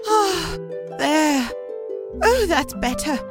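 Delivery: high, airy acted relief; relieved sigh. Teacup cools on the shelf after the oven, acted relief. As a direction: let each little phrase ease out with a pause.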